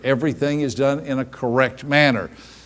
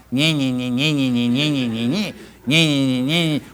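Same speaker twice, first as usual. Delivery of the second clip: mock whining. Speaker mocking how the questions sounded to her, sulky whine. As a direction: mimic a nagging voice with annoyed contempt. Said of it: "From my perspective, it sounded like".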